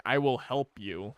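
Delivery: slow, lightly voiced dog. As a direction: speak slowly as a helpful dog. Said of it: "they're like".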